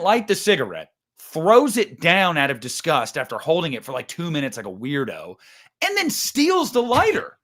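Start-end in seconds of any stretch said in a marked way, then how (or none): 5.8-7.4 outraged disbelief. Real, not acted. Outraged at Loomis stealing lighter.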